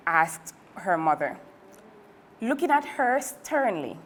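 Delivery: plain reading voice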